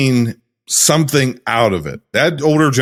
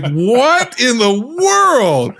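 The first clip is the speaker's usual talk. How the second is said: stunned disbelief; 7 semitones higher, much slower than usual. Retold or imitated. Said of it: "And I'm like".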